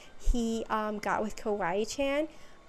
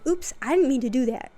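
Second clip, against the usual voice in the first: loud, lightly acted oops; imitating a sheepish excuse, loudly; mock oops; 2 semitones higher, 7 dB louder, faster than usual. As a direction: play up an accidental blunder. Voices Aphmau's accidental miracle.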